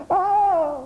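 high shrieking cry of terror; a sudden scream of fright; terrified cry. Acted. A frightened boy shrieks at a frog in his pocket. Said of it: "en poussant un cri de terreur".

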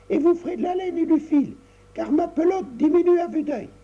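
put-on high little girl's voice, bossy and impatient. Tricotta renvoie sa mère au lit, voix aiguë impatiente. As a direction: shoo her mother off to bed. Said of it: "dit Tricotta avec impatience".

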